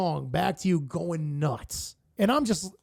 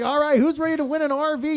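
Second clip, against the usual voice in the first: higher and louder hype call; mock excited announcer, pitched up; hype man; 9 semitones higher, 5 dB louder than usual. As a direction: pump up the crowd like an emcee. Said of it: "he was like".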